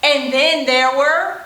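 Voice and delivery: bouncy, sing-song counting rhyme with whoops; playful children's finger-play chant; storytime action rhyme. Acted. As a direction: chant it for little kids doing the actions. Chants five perky penguins rhyme.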